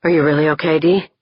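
plain reading voice

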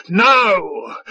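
drawn-out shouted command; imperious yell; screamed. A narrator's reading. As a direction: demand instant obedience. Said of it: "Five-Tide screamed".

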